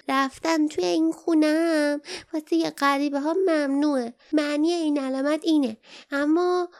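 high, worried little creature voice; a tiny uneasy creature explaining the warning sign; small creature voice. A narrator's reading. Perrin explains the hut to travelers, high creature voice. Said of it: "پرین آهسته گفت".

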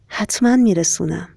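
ordinary delivery, nothing marked

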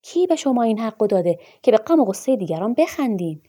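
high, loud outraged question; furious demand. A narrator's reading. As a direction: demand an answer, fist-on-the-table anger. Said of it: "بار دیگر مشتش را بر میز کوفت". (بازخواست با فریاد خشمگین)

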